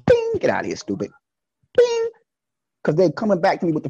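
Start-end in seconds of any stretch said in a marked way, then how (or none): 0.1-0.8 sudden high bing sound; God zapping someone away with a high ding; God's bing. Retold or imitated. God dismissing a fool with a high bing. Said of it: "God's like this". 1.8-2.1 sudden high bing sound; God zapping someone away with a high ding; God's bing. Retold or imitated. God dismissing a fool with a high bing. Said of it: "God's like this".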